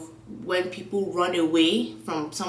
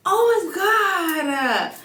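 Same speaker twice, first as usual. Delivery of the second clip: exclaiming; 13 semitones higher, 5 dB louder, much slower than usual. Real, not acted. Sudden outburst before a Tinder story.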